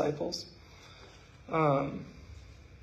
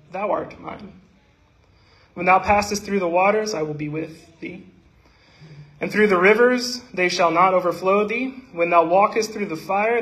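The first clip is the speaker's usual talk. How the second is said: raised, ringing recital of Isaiah; scripture reading; 2 semitones higher, 4 dB louder than usual. Acted. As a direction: proclaim the verses to the congregation.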